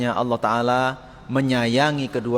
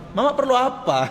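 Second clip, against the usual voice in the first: put-on gentle polite child; 10 semitones higher than usual. Shown as a demonstration. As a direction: ask sweetly and humbly. Polite child voiced to mother. (anak bertanya lembut dan sopan pada ibu)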